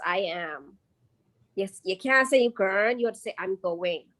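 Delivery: normal talking voice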